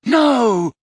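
high, loud shout of no; Harry shouting as Dumbledore falls. A narrator's reading. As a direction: cry out in shock. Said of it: "shouted Harry".

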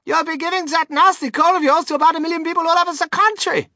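high, loud motherly telling-off; shrieky, outraged mother; scolding mother. A narrator's reading. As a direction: shout a scolding at high pitch. Mother scolds her son loudly.

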